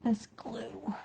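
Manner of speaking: soft breathy whisper. Real, not acted. Quilter mutters which glue she wants, in a whisper. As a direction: say it to yourself, barely voiced. Choosing glue while sewing.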